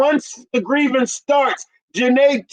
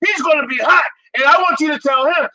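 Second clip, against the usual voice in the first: angry customer; 5 semitones higher, faster than usual. Acted. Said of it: "you and I will be like".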